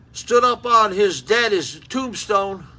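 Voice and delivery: usual speaking voice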